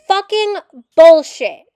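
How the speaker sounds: loud, slow, furious curse; furious outburst. Real, not acted. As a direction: spit it out in real anger. Angry rant at documentary.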